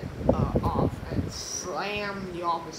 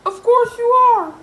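high, loud squeaky character voice; George; 18 dB louder than usual. Acted. George telling his friend it was a dream, high and loud. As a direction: pitch it way up for George.

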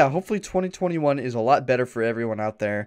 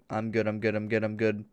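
I'm good, I'm good repeated fast and blurred together; rapid repetition; 4 semitones lower, 6 dB quieter than usual. Shown as a demonstration. Player shows how I'm good repeated sounds like get him. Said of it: "over and over again, it sounds like".

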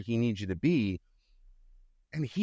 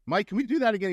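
lightly voiced director, pitched up; imitated director's request; 9 semitones higher, 3 dB louder, faster than usual. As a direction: call out a polite request for another take. Retelling director's request on set.

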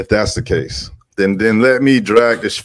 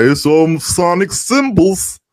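very high, sustained put-on voice; hammed-up high-pitched mockery; mocking conspiracy caller; 13 semitones higher than usual. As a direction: mimic an obsessive alarmist in a high monotone. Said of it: "Masons be like".

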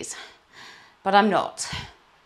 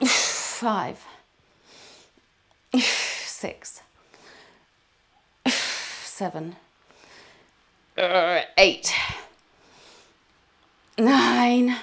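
exhausted sighing reps; 2 semitones higher, 7 dB louder, much slower than usual. Real, not acted. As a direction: sigh before every count. Sighing through each rep, tired.

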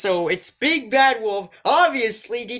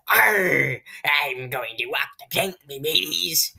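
low pirate growl on a long arr; lower-pitched imitation of a storybook pirate; mock pirate; 8 semitones lower than usual. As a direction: play a hammed-up pirate. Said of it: "And green pirate hat's like".

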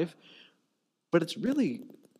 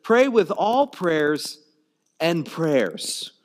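slow emphatic; 4 dB louder, much slower than usual. Real, not acted. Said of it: "translate as".

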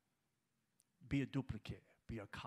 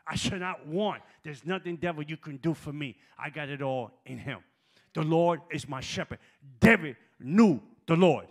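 loud, high, impassioned sermon climax; shouting the Psalm from the pulpit; full-force preaching; 4 semitones higher, 22 dB louder than usual. Acted. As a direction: whoop and thunder at the congregation.

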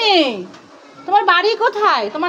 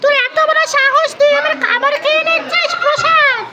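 angry scolding; 8 semitones higher than usual. Acted. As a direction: yell at the thieving cat. Child scolds the cat.

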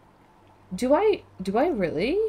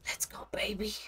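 hushed, whispered self-encouragement; barely voiced whisper to yourself; whispered hype; 3 semitones higher, 12 dB quieter than usual. Real, not acted. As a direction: whisper it like a private pep talk. Hyping self before next run.

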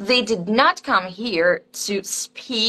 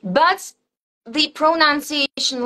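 ordinary talk

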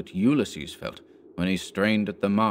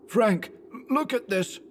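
much higher, urgent call to Frank; Anderson, alarmed; 13 semitones higher than usual. A narrator's reading. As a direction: Anderson showing Frank the hands.

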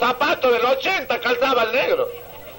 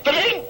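imitated phone ring; 5 semitones higher than usual. Joke, phone rings again.

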